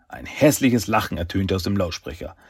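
plain reading voice

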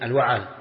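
الوعل said al-wa'al, with "a" after the ayn; second syllable of الوعل voweled "a": wa-'al; fatha on ayn. Shown as a demonstration. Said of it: "مع فتح العين".

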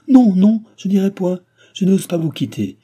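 high, loud, desperate refusal; desperate protest. A narrator's reading. Cécile refuses to leave him, desperate protest. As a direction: cry out against being sent away.

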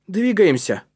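high, loud order; commanding. A narrator's reading. Калиб sends the boys on up the pipe, commanding. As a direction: give the order to move. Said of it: "скомандовал Калиб".

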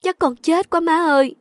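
high-pitched wailing complaint; distressed daughter. A narrator's reading. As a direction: whine to mother in despair. Upset daughter wailing to her mother. (than vãn tuyệt vọng, giọng cao)

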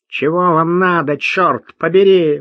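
высокий гнусавый голос бродяги; прогнусавить с сильным провинциальным выговором; гнусаво, с выговором. A narrator's reading. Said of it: "прогнусавил он сильным нееврским выговором". (гнусавый грубый деревенский говор)

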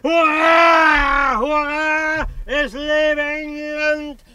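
put-on high drunken shouting, cheering nonsense; feigned drunk raving. Vicar fakes drunkenness at the lamp post, raving shout. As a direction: play the raving drunk at full volume. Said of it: "warf ich mich gegen sie und schrie".

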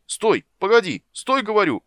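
very high, loud, frantic commands; urgent cries to halt; shouting. A narrator's reading. Rider to diving dragon, frantic shouting. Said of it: "Он кричал ещё что-то".

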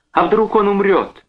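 very high, alarmed question; panicked. A narrator's reading. Wife fears her husband is dying, panicked. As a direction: fear the worst.

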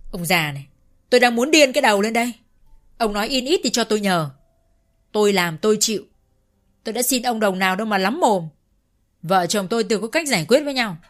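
loud irritated outburst; exasperated shout. A narrator's reading. Son shouting back at his nagging father, exasperated. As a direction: snap back at the old man. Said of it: "thở mạnh ra và khó chịu đáp".

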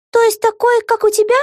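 high, cheeky jab; teasing. A narrator's reading. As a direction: tease your friend. Vega teases. (подкол)

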